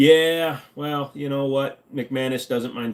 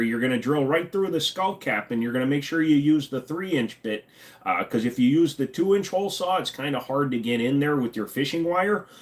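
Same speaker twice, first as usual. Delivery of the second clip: put-on higher-pitched Midwest accent, instructing confidently; folksy Midwestern doctor; 4 semitones higher than usual. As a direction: explain it like a Wisconsin handyman surgeon. Plays Midwestern doctor giving surgery instructions.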